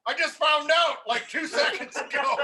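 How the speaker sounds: loud, high, laughing disbelief; telling the sergeant major the news, laughing loudly; laughing exclaim. Retold or imitated. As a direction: shout it through a laugh. Retold reply to sergeant major.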